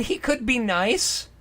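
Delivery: ordinary talk, nothing marked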